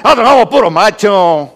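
mexicanos muy machos. Retold or imitated. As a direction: imitate proud Mexican men boasting. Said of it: "los mexicanos dicen". (fanfarronada de macho mexicano, imitada)

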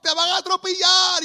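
high, loud warning shout at the dog; retold yell. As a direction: yell after the runaway dog. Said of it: "yo le gritaba".

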